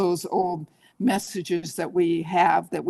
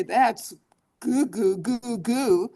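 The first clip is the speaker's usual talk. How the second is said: put-on baby talk; 3 semitones higher, slower than usual. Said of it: "And I say".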